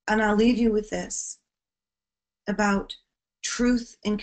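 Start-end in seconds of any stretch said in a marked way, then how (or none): none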